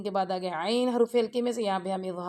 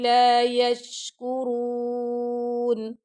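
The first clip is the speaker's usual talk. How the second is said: slow, melodic tajweed recitation; Quran recitation; 4 semitones higher, 6 dB louder, much slower than usual. Shown as a demonstration. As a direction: chant the verse slowly and evenly, teaching by example. Teacher recites Quran verse.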